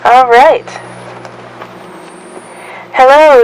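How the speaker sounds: usual speaking voice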